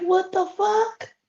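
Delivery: high, drawn-out retold disbelief; mock disbelief. Vlogger retelling the reaction to gossip, in high-pitched disbelief. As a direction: act out being floored, pitch way up and slow.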